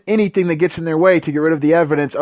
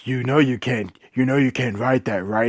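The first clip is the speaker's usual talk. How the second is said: smug villain; 6 semitones lower, 6 dB quieter than usual. Retold or imitated. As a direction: lower and much softer, taunting. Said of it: "he goes".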